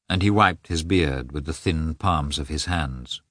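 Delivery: ordinary delivery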